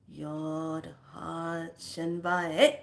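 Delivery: slow, stretched chant ending on a rise; drawn-out intoned phrase. Shown as a demonstration. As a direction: draw each word out deliberately. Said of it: "all of a sudden you say".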